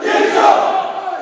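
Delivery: full-voice chant yell. Real, not acted. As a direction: scream it at the top of your lungs. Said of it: "to the highest of your voice can take".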